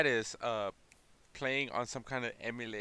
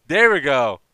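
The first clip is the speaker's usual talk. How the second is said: excited; 4 semitones higher, 17 dB louder, slower than usual. Real, not acted. Finally recalls the name.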